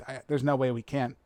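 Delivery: usual speaking voice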